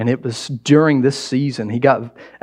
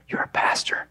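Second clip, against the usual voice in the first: half-whispered aside, much softer; hushed reproach; 9 dB quieter than usual. Acted. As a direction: drop to a near-whisper. Imagined inner reproach, said under breath.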